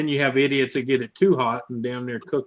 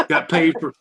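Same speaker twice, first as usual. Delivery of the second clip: louder, higher, gleeful brag; 6 semitones higher, 8 dB louder than usual. Real, not acted. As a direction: crow about it happily. Laughing, gleeful.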